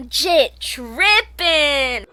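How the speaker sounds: very slow, stretched jit trippin; drawn out slang. Pretend. Streamer reacting to the game character. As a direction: drag each word out.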